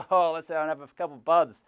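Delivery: mock bro cheer. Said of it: "a bud with the boys".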